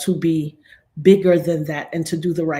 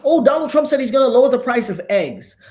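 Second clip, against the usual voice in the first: higher, louder mock of naive voters; mocking quote; 6 semitones higher, 5 dB louder than usual. Mimicking Trump supporters about egg prices, mocking. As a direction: parrot them with a scoffing sing-song. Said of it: "for some of y'all who look".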